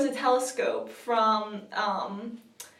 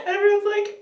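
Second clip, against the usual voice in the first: ordinary talk, nothing marked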